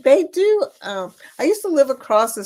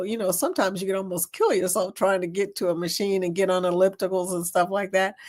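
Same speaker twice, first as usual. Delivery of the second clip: normal talking voice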